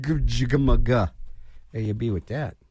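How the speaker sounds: slow DJ sign-off. Acted. DJ sign-off after promo.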